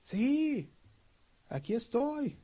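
high, light answering voice; a calm voice answers; voice from above. Retold or imitated. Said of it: "se oye una voz".

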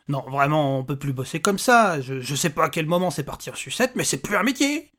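put-upon little man venting; exasperated little stranger. Acted. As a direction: grumble about an impossible job.

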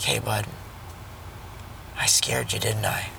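put-on soft, hushed words to a scared child; gentle apology. Father kneeling to comfort his frightened son, soft gentle apology. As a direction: kneel down and soothe him.

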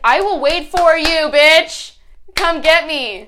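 loud, high, drawn-out mock challenge; mock bold declaration. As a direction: belt it out like an over-the-top dare. Sums up her message as bold challenge.

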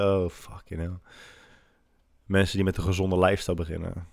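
usual speaking voice

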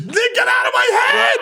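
high shouted outburst; excited shout. Real, not acted. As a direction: yell it out in disbelief.

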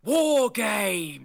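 booming, announcer-style shout of the name; Willie Regal voice. Retold or imitated. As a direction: bellow it like a grand wrestling commissioner. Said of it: "Your best Willie Regal voice".

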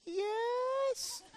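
tiny, distant squeaky reply; soft, high-pitched little boy impression. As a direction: answer in a small, very high kid's squeak. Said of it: "You still had that high, squeaky voice".

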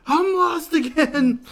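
high, loud, drawn-out yell of a lost man; distant lost yell. Retold or imitated. Todd yells offscreen to Bojack that he's lost, loud drawn-out yell. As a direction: bellow it slow from somewhere off. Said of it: "you just hear him yell".

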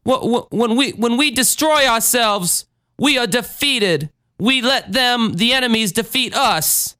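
high, halting plea to the congregation; church member speaking up. Acted. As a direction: stammer into it, then stretch the phrase endings. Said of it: "Then a church member speaks up".

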